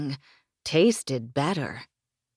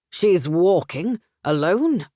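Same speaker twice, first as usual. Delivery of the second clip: loud, slow alarmed disbelief; alarmed, loud and drawn out; 3 semitones higher, 7 dB louder than usual. Acted. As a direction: shout it in sudden alarm. Alarmed brother on phone.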